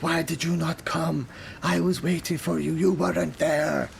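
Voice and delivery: a mild mock yell of a lost captain; captain yelling at sky. Storm-lashed captain calls to the sky, mild mock yell. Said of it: "you can hear him yelling, shouting at the top of his voice".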